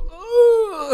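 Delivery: a high, squealed ooh of disgust; imitated child's disgusted ooh. Child reacting to the smell with a high ooh. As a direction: squeal it like a kid hit by the smell. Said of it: "one of them goes".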